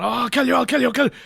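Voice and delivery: high, loud mock-distressed threats; hammed-up frantic repetition; Cary Elwes. Podcaster mimicking actor threatening the kidnapper, mock distressed. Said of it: "very distressed".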